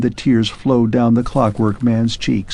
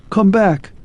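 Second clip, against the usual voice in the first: mild shout, a bit raised; shouted call; 9 semitones higher than usual. Acted. Arthur calls after the fading clockwork man, mild shout. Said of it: "shouted Arthur".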